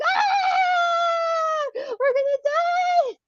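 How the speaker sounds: high, loud wail of doom; re-enacted terrified shriek; panicked scream. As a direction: scream it slowly, sure you're about to die. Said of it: "And I was screaming".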